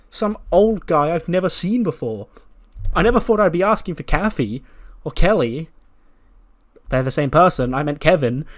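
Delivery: acted haughty grievance; indignant complaint. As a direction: play up the affronted star's disbelief. Acted diva complaint to boss.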